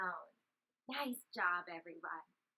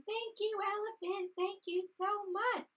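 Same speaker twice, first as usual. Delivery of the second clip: rabbit voice; 7 semitones higher, 5 dB quieter than usual. Shown as a demonstration. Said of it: "Can we be the rabbit".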